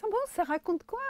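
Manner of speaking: high curious squeak; high-pitched voice. Retold or imitated. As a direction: ask brightly, way up high. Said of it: "Elle avait une voix un peu aiguë". (voix aiguë intéressée)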